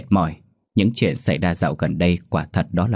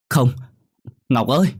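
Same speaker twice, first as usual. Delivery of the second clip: raised, desperate mother's cry; horrified cry; 6 semitones higher, 3 dB louder than usual. Acted. Mother sees daughter dropped from window, horrified cry. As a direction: cry out for your child in horror.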